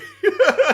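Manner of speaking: sudden high laugh; real high cackle; high burst of laughter. Real, not acted. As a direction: laugh out loud, high and bright. Laughing at own story.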